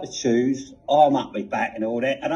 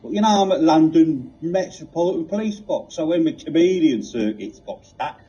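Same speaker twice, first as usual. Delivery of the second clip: put-on Cockney accent. The TARDIS answering Reg, in a world-weary Cockney accent. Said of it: "such a pronounced, uh, Cockney accent".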